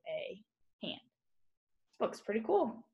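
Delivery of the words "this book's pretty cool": ordinary delivery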